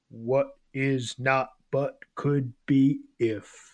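slow, deadpan baritone line. Retold or imitated. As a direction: read it slow and deadpan, like a record intro. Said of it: "baritone, deadpan voice say".

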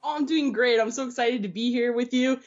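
ordinary talk, nothing marked

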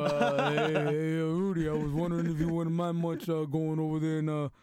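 low mumbling Italian-American boxer; Rocky voice. Retold or imitated. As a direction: slur it like Rocky Balboa. Doing a Rocky impression.

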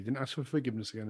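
ordinary talk, nothing marked

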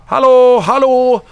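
loud, high call into the dark; retold calling out. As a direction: shout hallå to be heard.

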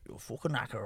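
low, soft Dublin accent. Shown as a demonstration. Comic recalling his accent bit, Dublin accent. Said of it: "I'd do a Dublin".

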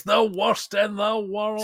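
rising to a high, drawn-out finish; hammed-up grand verdict; slow it down and send the last words soaring; dramatic exaggeration. Mock-grand verdict on the interface.

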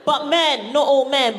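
ordinary delivery

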